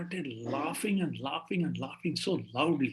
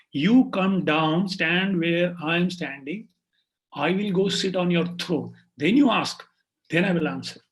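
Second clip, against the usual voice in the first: bold command; 5 dB louder than usual. Acted. Cowherd boy telling the king to swap places, commanding. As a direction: lay down terms, weighting each phrase.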